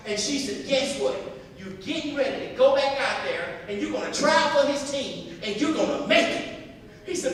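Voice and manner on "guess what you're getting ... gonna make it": loud, higher, forceful encouragement; acted motherly pep talk, full force; rousing mother. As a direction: fire up a discouraged son. Mother urges son to try again.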